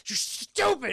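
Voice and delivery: high, loud scornful retort; mock berating. Sarah berating John who came to rescue her, mock fury. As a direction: snap back in mock motherly fury. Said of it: "she's berating him horrifically".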